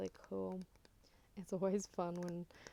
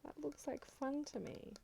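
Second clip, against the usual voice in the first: squealing with hammed-up delight at Walmart; mock excitement; 7 semitones higher than usual. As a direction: gush like a kid spotting a toy store. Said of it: "I'm just like".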